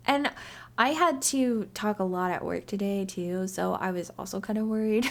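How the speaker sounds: usual speaking voice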